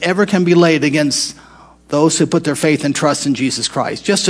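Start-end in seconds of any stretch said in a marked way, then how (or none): none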